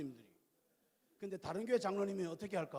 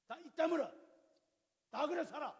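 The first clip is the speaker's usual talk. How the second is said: higher, louder brush-off; dismissive husband; 9 semitones higher, 4 dB louder than usual. Retold or imitated. Husband shuts down wife's anger, higher and dismissive. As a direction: wave her complaint away like a curt husband. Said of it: "이러면 안 돼".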